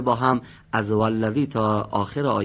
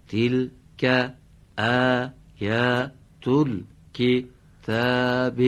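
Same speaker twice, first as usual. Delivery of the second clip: slow Quran recitation; 3 semitones higher, 5 dB louder, much slower than usual. Shown as a demonstration. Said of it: "همین قسمت تلک رو با سرعت هم بفرمید".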